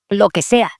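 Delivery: ordinary talk, nothing marked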